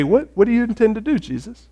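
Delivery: hesitant, quick question fading away at the end; sheepish imitation. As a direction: ask meekly, testing the water. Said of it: "in a sheepish way".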